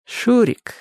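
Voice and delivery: high, earnest call by name; pointedly sincere, with meaning; heartfelt address. A narrator's reading. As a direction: address him soulfully. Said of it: "проникновенно ответил Чик".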